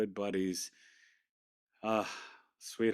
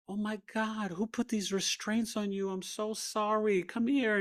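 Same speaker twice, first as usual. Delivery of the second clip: doting nurse; 10 semitones higher than usual. Retold or imitated. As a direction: coo over him like a sweet nurse. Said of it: "she's like".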